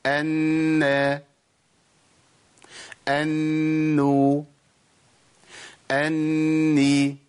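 أن، أنو، أني each held on a long humming n; long nasal hum on every doubled nun; two-count ghunnah. Shown as a demonstration. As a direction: hold the doubled n in أنو through the nose, steady pitch. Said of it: "بغن حركتين".

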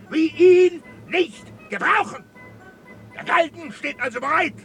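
high, emphatic angry harangue; furious officer laying down the law; threatening tirade. Acted. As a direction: build to a shouted threat of punishment. Commandant's threatening tirade, gallows.

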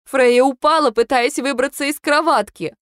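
high, urgent plea; wife pleading daughter just fell; desperate explanation. A narrator's reading. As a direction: insist it was an accident.

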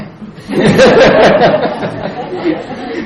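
long, high-pitched burst of laughter; high, drawn-out cackling laugh; hearty laugh. Real, not acted. Laughs at his own joke.

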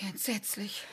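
breathless horrified whisper; shocked whisper. Acted. Yvette hears the boy was strangled, stunned whisper. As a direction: barely voice it, aghast.

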